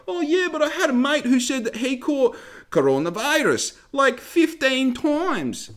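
high, very slow mock-dopey parody; mocking dimwit voice. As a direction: drag it out like a gullible fool. Mocking a misinformed person.

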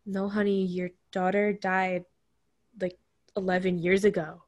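wife gently explaining, understated tenderness; gentle explaining. Retold or imitated. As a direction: quietly tell a confused husband the truth. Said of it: "she has to explain".

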